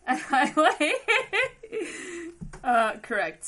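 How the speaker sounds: laughing loudly, high and loud. Real, not acted. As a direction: burst out laughing mid-story. Laughing through the retelling.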